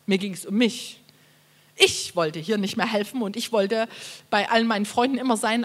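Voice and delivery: ordinary talk, nothing marked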